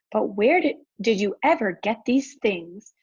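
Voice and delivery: ordinary delivery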